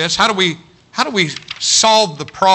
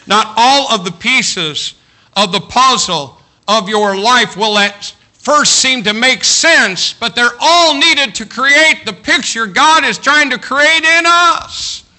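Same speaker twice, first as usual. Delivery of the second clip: rising, louder preacher's climax; preaching at full force; 7 semitones higher, 5 dB louder, slower than usual. Real, not acted. Pastor building to a ringing climax for the congregation. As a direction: build the line up to a ringing peak.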